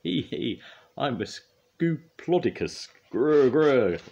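high giggly pretend dinosaur; giggle, then growl loudly in play; high, slow, playful monster boast; Scoop. A narrator's reading. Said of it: "laughed Scoop".

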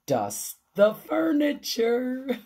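sing-song quoting of the list; quoting Missus Rogers. Retold or imitated. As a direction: read out the instruction high and deliberate. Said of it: "Missus Rogers said".

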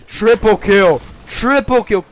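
loud, high triumphant yell; excited shout. Real, not acted. Gamer announcing a triple kill, excited shout.

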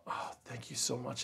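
very soft, hushed mock-tearful gratitude; imitating tearful thanks. Abuse victim thanking the man offering help, softly imitated tearful thanks. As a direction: quietly act out a crying woman's thanks. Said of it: "and she's crying".

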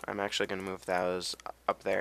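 ordinary talk

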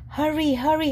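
plain reading voice

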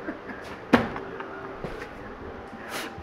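can't stop laughing; helpless laugh. Real, not acted. Retelling the kid's line, cracking up uncontrollably.

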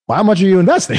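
retold skeptical challenge; incredulous question. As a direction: put the question to him in mock disbelief. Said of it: "I'm like".

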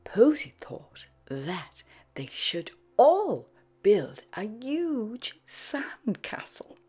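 usual speaking voice

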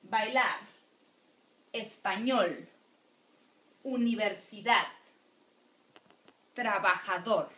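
"Bailar, español, universidad, trabajador", each final syllable punched; a list "Bailar, español, universidad, trabajador", each word landing firmly on its ending; last syllable stressed. Shown as a demonstration. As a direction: hit LAR, ÑOL, DAD, DOR in "Bailar, español, universidad, trabajador". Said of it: "are usually stressed on the last syllable".

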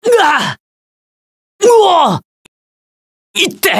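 high, startled yelps of pain; sharp cries when taking damage; hit yelps. Acted. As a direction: get struck and yelp. Taking hits in combat.

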